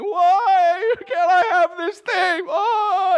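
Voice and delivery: high, slow, tearful whine; whiny crying plea. Retold or imitated. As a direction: wail it like a sulking child begging. Preacher mimics his own whining plea.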